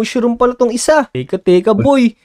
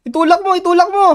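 urgent; 6 semitones higher, faster than usual. Real, not acted. Urging push on zombie.

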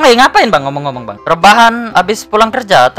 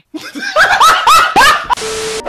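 shrieking laugh. Acted. Loud laugh clip.

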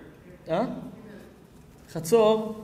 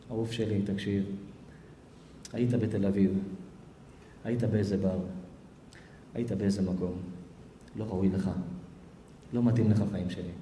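soft, tender, lower voice; gentle loving rebuke; 10 semitones lower, 9 dB quieter, faster than usual. Shown as a demonstration. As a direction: scold him gently, like a loving father. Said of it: "צריך להגיד לך שאתה טעית, אבל יש דרך". (תוכחה רכה ואוהבת, בקול שקט ונמוך)

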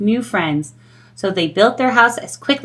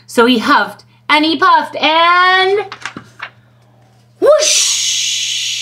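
building excitement; 7 semitones higher, much slower than usual. A narrator's reading. Building to the blow.

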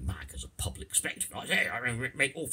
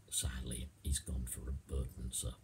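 softer, lower, sombre report to an officer; quiet sad report; 7 semitones lower, 7 dB quieter than usual. Acted. Airman quietly telling his officer that a pilot died. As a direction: lower the voice for the bad news.

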